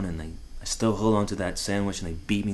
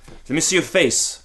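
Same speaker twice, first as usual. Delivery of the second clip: higher, insistent father; demanding father; 6 semitones higher than usual. Retold or imitated. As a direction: order the boy to show his face.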